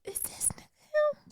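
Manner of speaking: high, hushed whisper of disbelief; high stage whisper, as if to a friend; incredulous whisper. Pretend. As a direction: mutter it under your breath, amazed. Joking about spotting Zuckerberg.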